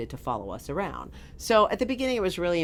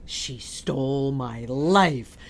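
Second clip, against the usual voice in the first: slow dramatic; 3 semitones lower, 4 dB louder, much slower than usual. Retold or imitated. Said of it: "I was like".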